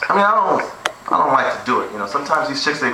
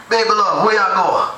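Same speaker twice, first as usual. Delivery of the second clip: womanizer in Jamaica; 5 semitones higher than usual. Retold or imitated. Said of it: "You know".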